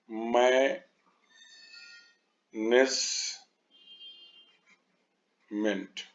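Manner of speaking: Management in three isolated beats with long pauses, मै, नेज, meant; split into syllables. Shown as a demonstration. Teacher showing three syllables, very slow and split. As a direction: break management very slowly into three pieces. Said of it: "तीन में हो गया".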